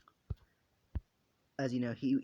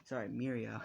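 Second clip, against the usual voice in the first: plain reading voice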